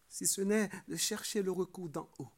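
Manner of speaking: normal talking voice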